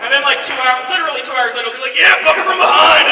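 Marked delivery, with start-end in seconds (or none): 2.0-3.1 loud, raised mock-lustful cry; higher, louder, comic urging; carried-away outburst. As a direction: cheer it on with hammed-up gusto. Mimes himself carried away again.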